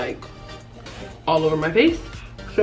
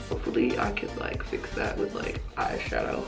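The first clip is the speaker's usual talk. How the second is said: whispering; 9 semitones lower, 9 dB quieter than usual. Real, not acted. Unvoiced trailing aside.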